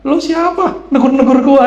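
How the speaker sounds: annoyed retort. Retold or imitated. Stranger annoyed at being hailed.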